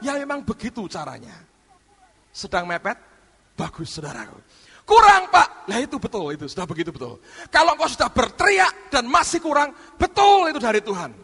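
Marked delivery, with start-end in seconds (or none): 4.8-5.7 high, loud whine; loud complaint. Retold or imitated. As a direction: complain loudly to the pastor. Imitated congregation complaint. (keluhan keras kepada pendeta)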